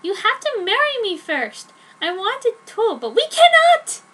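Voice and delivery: high heroine voice rising to a loud cry; Joan insisting. Acted. Heroine telling Edward to marry her first, rising to a shout. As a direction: insist harder and harder until you shout. Joan's voice in the story.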